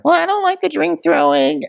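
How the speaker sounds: very high put-on squeak; squeaky prissy voice. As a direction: squeak it like a prim housewife. Ashley voiced in story.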